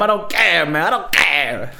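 high, loud, hammed-up Italian rant; mock Italian-English, worked up and high-pitched; Italian accent. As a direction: go over the top as an excitable Italian. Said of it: "I just thought that the Italian".

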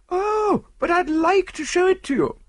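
very high, gushing insistence; insistent society lady. Acted. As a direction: insist eagerly in a high lady's voice. Lady insists on showing pearls.

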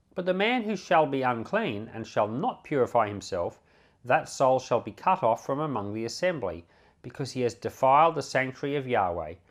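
ordinary talk

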